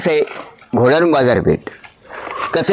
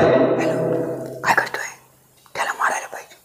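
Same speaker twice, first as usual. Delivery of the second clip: breathless whisper, much softer; whispered plotting; 7 dB quieter than usual. Shown as a demonstration. Guide acts out secret war talk, whispering. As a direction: whisper the plan to kill. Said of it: "आम्ही बोललो तर आवाज आहे ना".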